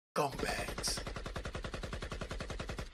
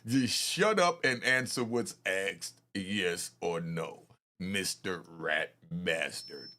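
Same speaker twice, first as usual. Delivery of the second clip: stern order; 14 semitones lower, slower than usual. Retold or imitated. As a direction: lay it down slowly like a strict prosecutor. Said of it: "They had to tell the rat bastard".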